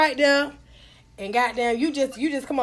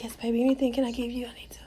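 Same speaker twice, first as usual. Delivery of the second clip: hushed, submissive whisper; very soft mock-meek whisper; whisper demo; 3 semitones lower, 8 dB quieter, faster than usual. Shown as a demonstration. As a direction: whisper it meekly to show how. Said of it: "You whisper, you whisper".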